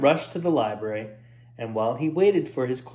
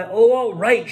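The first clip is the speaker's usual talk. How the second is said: loud, higher grudging shout; 6 semitones higher, 7 dB louder than usual. Acted. Roy shouting his grudging consent to Jarvis. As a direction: give in with a shout. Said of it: "shouted Roy".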